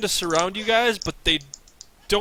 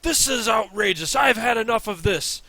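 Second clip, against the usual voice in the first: angry outburst; 6 semitones higher than usual. Acted. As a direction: rage at the whole crowd. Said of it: "he says".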